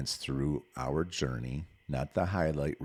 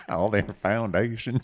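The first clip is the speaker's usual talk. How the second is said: put-on Southern belle accent; 6 semitones higher, 5 dB louder than usual. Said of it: "talking like a Southern belle over there".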